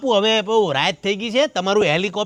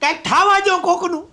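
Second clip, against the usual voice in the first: high-pitched, exasperated ordering away; agitated pleading to go, voice pitched right up; worked up; 9 semitones higher than usual. Acted. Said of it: "તમે શાંત થઈ જાઓ". (ઊંચા અવાજે ઉશ્કેરાઈને)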